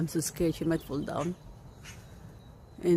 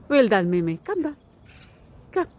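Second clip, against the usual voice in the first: louder praise, then a much higher coaxing "come down"; encouraging praise; 4 semitones higher, 6 dB louder than usual. Real, not acted. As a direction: praise her, then coax her down sweetly and high. Praising cat coming down.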